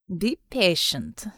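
low, slow, silky devil voice; a devil soothing an ally, unhurried; the devil, calm. Acted. The devil reassures dark man.

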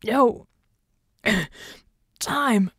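choked words broken by throat-clearing; halting, gassed effort to speak; coughing. A narrator's reading. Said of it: "Red coughed".

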